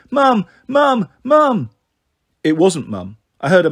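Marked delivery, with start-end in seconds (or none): 0.1-1.7 much higher, loud repeated calling; a little boy yelling for his mother at the door; excited shouting. A narrator's reading.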